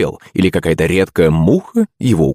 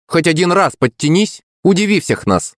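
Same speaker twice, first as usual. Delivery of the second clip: high, goading call; mild taunting shout; 11 semitones higher than usual. A narrator's reading. Classmate daring the girl to pull up, goading. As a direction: challenge her with pitch raised high. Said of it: "крикнул он".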